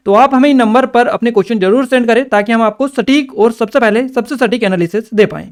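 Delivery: normal talking voice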